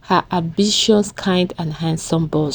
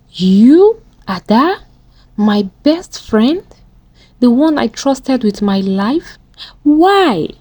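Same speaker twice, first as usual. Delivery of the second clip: louder, rising, wounded disbelief; hurt outburst; 5 semitones higher, 4 dB louder, slower than usual. A narrator's reading. Nena confronting her best friend, hurt and louder. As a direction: demand why, stung by betrayal.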